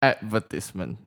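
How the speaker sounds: Advertisement said as AD-ver-tis-ment; low, even Advertisement, stressed up front; non-British pronunciation. Shown as a demonstration. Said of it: "What's the difference?".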